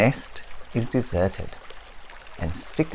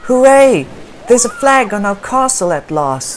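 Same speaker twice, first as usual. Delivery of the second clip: very high, loud joyful cheer; excited child cheering; 13 semitones higher, 10 dB louder than usual. Acted. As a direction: whoop with triumph.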